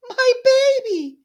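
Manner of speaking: high, loud, drawn-out cry of a mother; anguished scream. Acted. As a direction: scream for the lost child. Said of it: "Esme screamed".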